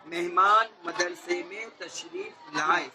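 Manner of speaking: slow, carefully separated words; measured model sentence, drawn out for clarity; clear enunciation. Shown as a demonstration. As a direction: say each word cleanly, taking your time. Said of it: "صفائی کے ساتھ بولتے ہیں".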